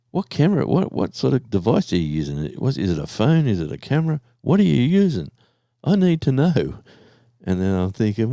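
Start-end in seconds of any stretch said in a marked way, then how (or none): 0.1-6.8 eager fan. Retold or imitated. Mimicking a viewer desperate to know his camera, eager. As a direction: pester him like a keen fan.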